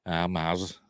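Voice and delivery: a touch of broad Northern English greeting. Pretend. Teasing Az's off-camera talk, lightly exaggerated Northern English accent. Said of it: "when he's not on camera".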